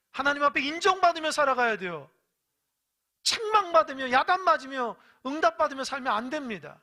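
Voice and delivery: loud, high-pitched pulpit exhortation; forceful preaching. Real, not acted. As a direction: drive the warning home at full voice.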